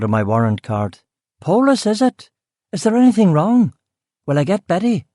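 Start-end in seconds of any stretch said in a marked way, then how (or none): 1.4-5.1 high, anxious West Belfast voice; worried Belfast cook, voice jumping up; alarmed Belfast cook. Acted. Cook seeing a warrant card, alarmed, in a high Belfast accent.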